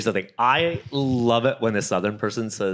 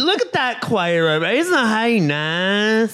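much higher, slow, drawn-out Southern twang; mock Southern voice; 8 semitones higher, slower than usual. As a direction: play a nosy Southern neighbor pointing someone out. Joining the Southern impression.

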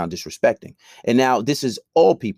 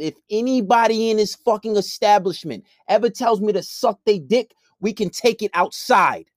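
loud warning; 10 semitones higher than usual. Retold or imitated. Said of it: "I said it out loud to everyone".

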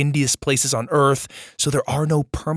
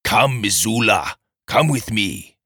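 mysterious character voice; 6 semitones lower than usual. Pretend. Host imagines Missoula as a character's name, in a low beckoning voice. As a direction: lure someone in as a storybook stranger. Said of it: "sounds like the name of a character".

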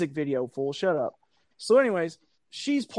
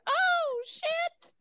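squeaky, much higher mock yelp of fright; startled teens; 3 dB quieter than usual. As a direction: jump out of your skin like a caught kid. Startled teens caught on ledge.

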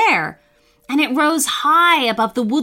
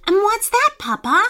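impatient; 12 semitones higher than usual. A narrator's reading. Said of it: "asked Charlie impatiently".

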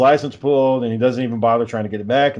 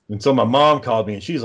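usual speaking voice

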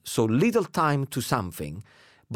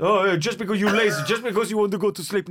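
fast, high teasing accusation; mock accusing; 8 semitones higher, 3 dB louder, faster than usual. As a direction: rattle off the mocking jab. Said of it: "And he went".

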